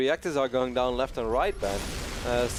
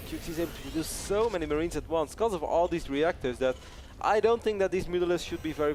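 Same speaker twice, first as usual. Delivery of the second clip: usual speaking voice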